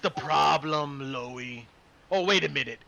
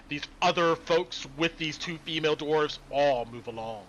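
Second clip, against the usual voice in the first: normal talking voice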